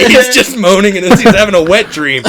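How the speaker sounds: punchline delivered through laughter; laughing. Real, not acted. Friend revealing the sleeper wasn't pretending, laughing. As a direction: crack up as it comes out.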